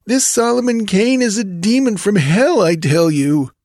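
high-pitched, sullen henchman; sullen reply. A narrator's reading. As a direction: protest sullenly to your chief. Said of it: "The fellow spoken to replied sullenly".

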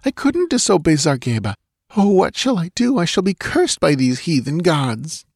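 high, pleading wail; abject fright. A narrator's reading. Dancing girl excusing herself to Conan, abject fright. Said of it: "quivering with abject fright".

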